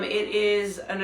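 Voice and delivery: normal talking voice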